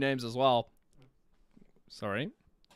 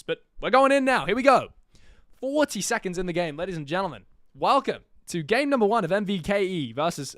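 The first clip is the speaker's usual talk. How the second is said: loud, high hype to kick off the game; hyped caster launch; 5 semitones higher, 6 dB louder than usual. Acted. Caster hyping the viewers as game one begins, loud and high. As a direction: sell the start like a live caster.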